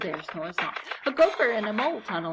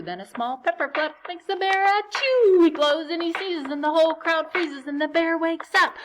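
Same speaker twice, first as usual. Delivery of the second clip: louder, higher rising storytelling tension; building excitement; 8 semitones higher, 6 dB louder than usual. A narrator's reading. Narrator reading the bear waking to children, louder and higher. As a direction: build up to the big moment.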